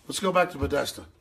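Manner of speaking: normal talking voice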